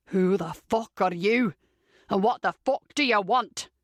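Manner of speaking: raspy, deep Scottish smoker; thick Scottish accent. A narrator's reading. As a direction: growl it out in gruff Scottish. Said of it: "inquires the thick Scottish accent. It's raspy and deep".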